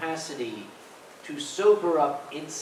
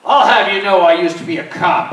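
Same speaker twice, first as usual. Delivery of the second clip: loud, higher boast; puffed-up boast; 6 semitones higher, 12 dB louder than usual. Retold or imitated. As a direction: puff yourself up and brag. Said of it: "this guy looked down at Rennie".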